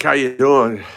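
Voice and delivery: ordinary talk, nothing marked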